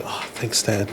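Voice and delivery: understated, under-the-breath acknowledgement; hushed reply. Retold or imitated. Son replaying his answer to dad's praise, hushed reply.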